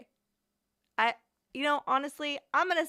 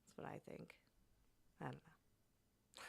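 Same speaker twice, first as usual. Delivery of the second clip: whisper; 6 semitones lower, 25 dB quieter than usual. Real, not acted. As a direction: murmur it like a secret. Whispered conspiracy aside.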